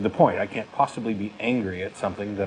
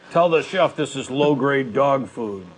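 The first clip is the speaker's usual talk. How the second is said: slow, higher and louder mock-scathing judge; a slow, higher, louder put-on Simon Cowell put-down; Simon Cowell impression; 6 semitones higher, 5 dB louder, slower than usual. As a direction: sneer it like a harsh talent-show judge. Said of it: "think Simon Cowell".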